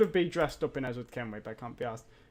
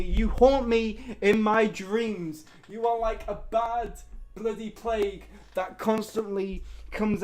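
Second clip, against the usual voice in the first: higher, raised-voice lament; exasperated tirade; 4 semitones higher, 5 dB louder than usual. Real, not acted. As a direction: rant in melodramatic torment at the unwanted arrival. Ranting at the unwanted character.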